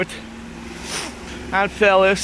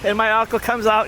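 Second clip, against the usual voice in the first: ordinary delivery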